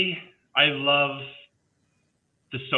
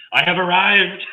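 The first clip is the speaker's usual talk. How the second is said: mock triumph; 7 semitones higher, 8 dB louder, slower than usual. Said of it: "I was like".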